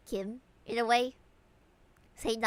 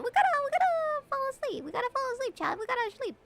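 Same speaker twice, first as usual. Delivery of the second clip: high pleading voice; 12 semitones higher, faster than usual. Acted.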